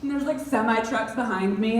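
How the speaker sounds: usual speaking voice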